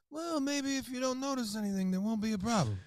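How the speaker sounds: lightly voiced mob boss with a veiled threat; mafia boss. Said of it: "picture, like, a mafia family".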